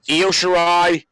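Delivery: ordinary talk, nothing marked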